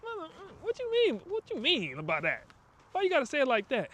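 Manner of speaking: high, offended "what you mean"; indignant retort. Retold or imitated. To a relative's remark about the business, a high indignant retort. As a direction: bristle back in a high, put-out voice. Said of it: "you wanna be like".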